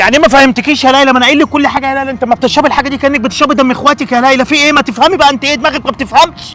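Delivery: angry father scolding. Acted. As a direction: let the fury rise at her stubbornness. أب يوبخ ابنته بغضب. (أب غاضب يوبخ بنته بصوت عالي)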